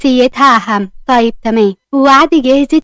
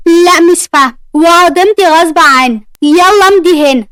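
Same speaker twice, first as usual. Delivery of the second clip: higher and louder, arguing back; heated argument; 4 semitones higher, 4 dB louder than usual. Acted. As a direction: push back hard at the boss. Argument with new director.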